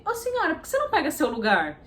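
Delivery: high, loud busybody calling out; a stranger challenging the old lady; nosy woman. Retold or imitated. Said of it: "uma mulher falou assim". (mulher intrometida chamando, aguda)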